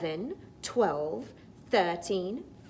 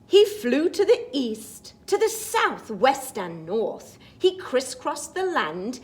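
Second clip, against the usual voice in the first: put-on lively read-aloud for children; storybook reading; 11 semitones higher, 11 dB louder, slower than usual. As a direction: read it like a bedtime picture book. Reads the picture book rhyme.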